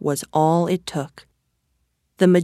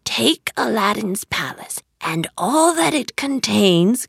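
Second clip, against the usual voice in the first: mildly menacing magician's order; rough-edged, emphatic command; 3 semitones higher than usual. A narrator's reading. Magician orders the genie of the lamp, commanding. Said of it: "commanded the magician".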